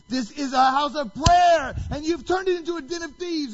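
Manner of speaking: thundering high shout of rebuke; loud, aggressive. Retold or imitated. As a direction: shout it out, outraged and forceful. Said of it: "loudly, aggressively".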